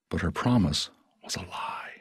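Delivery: soft whispered bitterness. A narrator's reading. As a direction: drop to a hush.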